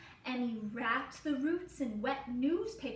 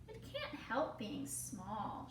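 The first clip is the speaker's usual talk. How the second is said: soft and sad; 3 semitones lower, 8 dB quieter than usual. Acted. Child sadly defends tree.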